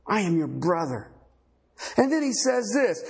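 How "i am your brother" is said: subdued cry. Retold or imitated. Preacher repeating the man's response to his brothers, subdued. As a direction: bring the imitated cry down to usual pitch. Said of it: "He cried".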